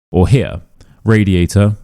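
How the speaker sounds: ordinary delivery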